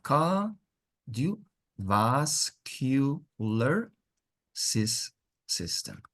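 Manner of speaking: very slow, carefully spaced; slow model. Shown as a demonstration. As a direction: draw "cardiovascular" out slowly. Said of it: "teacher models word slowly".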